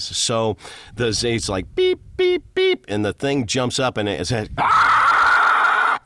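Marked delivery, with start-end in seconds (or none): 1.8-2.8 very high piercing bird cries; pterodactyl screech. Shown as a demonstration. Voice actor recreates his creature noise for the host, piercing screech. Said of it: "my, like, pterodactyl noise". 4.6-6.0 loud raw guttural scream; horrible zombie shriek. Shown as a demonstration. Showing how the zombie's cry sounded in the film, loud shriek. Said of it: "This horrible sound".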